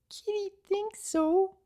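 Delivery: very high, slow timid creature voice; soft tiny voice. A narrator's reading. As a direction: barely dare to answer. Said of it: "she said softly".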